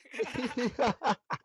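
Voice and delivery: high burst of laughter; laughing. Real, not acted. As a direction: crack up at a prank victim. Friend muted himself for real.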